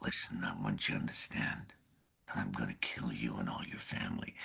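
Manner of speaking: low, quiet, threatening whisper; menacing whisper. Shown as a demonstration. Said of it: "if you wanna be quiet".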